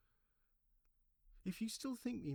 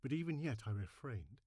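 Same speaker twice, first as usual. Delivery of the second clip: plain reading voice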